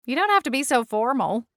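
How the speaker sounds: ordinary delivery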